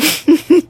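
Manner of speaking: loud, high burst of laughter. Real, not acted. Woman laughing at how triggered she is, high and loud.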